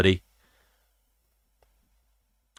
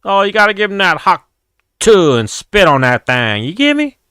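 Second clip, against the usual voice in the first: Hawk Tuah girl impression; 10 semitones higher, 4 dB louder than usual. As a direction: mimic her sassy street-interview line, pitched way up. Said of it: "the Hawk Tuah girl came up".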